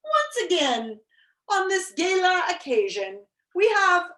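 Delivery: high, ringing, spaced-out proclamation; royal oration. A narrator's reading. King continuing his banquet speech, high grand oratory. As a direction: orate grandly with pauses between phrases. King's speech to empty room.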